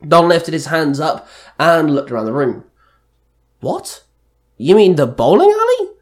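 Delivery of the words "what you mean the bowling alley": high-pitched, disbelieving questions; shocked. A narrator's reading. Bowler offered the bowling alley, asking in shock. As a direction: start small and high, then burst louder. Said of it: "Max asked in shock".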